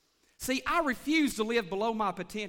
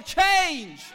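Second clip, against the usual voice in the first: shouted call to the congregation; loud rallying cry; holler; 7 semitones higher, 10 dB louder than usual. Real, not acted. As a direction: belt the word out loud and high. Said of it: "Everybody holler".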